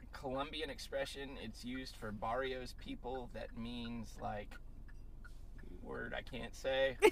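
softly reading a crude definition; hushed reading. Real, not acted. Reading an offensive entry aloud.

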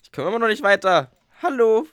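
high, loud whiny complaint; whiny call out. Real, not acted. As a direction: call out at the stuck screen. Frustrated at the app, calling out.

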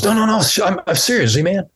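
high pitched insistent friend; high retold insisting. Voices Phil insisting.

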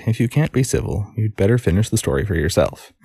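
usual speaking voice

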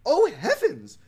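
high, loud storybook heroine; startled cry. A narrator's reading. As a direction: cry out in theatrical surprise. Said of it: "she cried".

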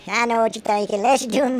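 ordinary talk

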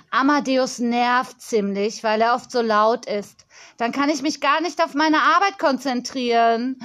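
put-on irritated, emphatic girl's voice; annoyed young girl. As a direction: play a busy little boss griping.

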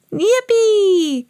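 put-on high-pitched child cheer; gleeful little pig's yippee; excited cry. A narrator's reading. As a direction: squeal it high and long. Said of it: "cried Peppa".

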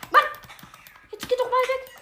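high, loud frantic yell; frustrated shout. Real, not acted. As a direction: yell at it to go away. Yelling at attacking mob.

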